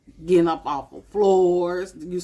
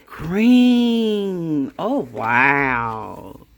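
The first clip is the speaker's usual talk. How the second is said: delighted exclamation; 5 dB louder, much slower than usual. Real, not acted. Admiring new calendar page.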